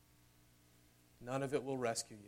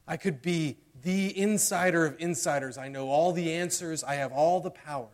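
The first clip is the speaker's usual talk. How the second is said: louder, rising preacher's build; preaching at full force; 4 semitones higher, 8 dB louder than usual. Acted. Preacher building to the point of the sermon, louder. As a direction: preach it out with fervour.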